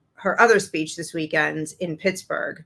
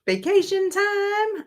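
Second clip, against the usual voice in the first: high, drawn-out mock of a put-on rally accent; exaggerated put-on dialect, pitched way up; imitating Kamala's put-on accent; 14 semitones higher, slower than usual. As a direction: mockingly stretch out a politician's fake accent, high and slow. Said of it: "do people talk like that".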